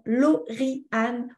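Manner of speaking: name chopped into syllables; robotic, syllable-by-syllable name; robot talk. Shown as a demonstration. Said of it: "je parle comme un robot pour dire le prénom".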